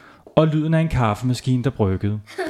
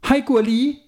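cheery mom; 11 semitones higher than usual. A narrator's reading.

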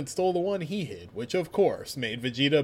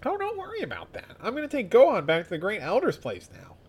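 high voice; 8 semitones higher than usual. Acted. Voicing Krillin.

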